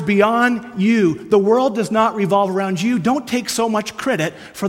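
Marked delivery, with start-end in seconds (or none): none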